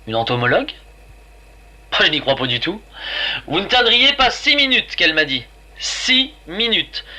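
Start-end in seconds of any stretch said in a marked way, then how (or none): 3.5-5.0 higher-pitched, disbelieving echo of a quote; incredulous quoting. A narrator's reading. Narrator throws the biochemist's six minutes back, incredulous. 5.8-7.1 "Six minutes" drawn out slowly, high; stressed repetition. A narrator's reading. Narrator marvels at the precise number, "Six minutes" slow and incredulous.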